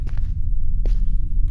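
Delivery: sharp frightened gasp; scared gasp. Real, not acted. Spotting the killer.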